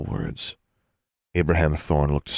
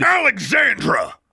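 loud, drawn-out, high-pitched roar; thundering shout; 8 dB louder than usual. A narrator's reading. Father shouting down his daughter, thundering. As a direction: thunder the name over her. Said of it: "His voice thundered over hers".

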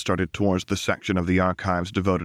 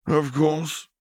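higher, flatly unapologetic agreement; 8 semitones higher than usual. A narrator's reading. Counselor brushes off Lux's loss, wholly unapologetic. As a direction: concede without a shred of regret. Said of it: "sounding as unapologetic as it was likely possible".

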